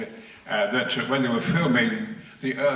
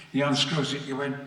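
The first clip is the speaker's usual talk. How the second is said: usual speaking voice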